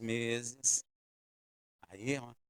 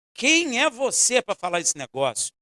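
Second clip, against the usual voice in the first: indignant retort; 8 semitones higher, 5 dB louder than usual. Retold or imitated. Angry retort to smiling stranger.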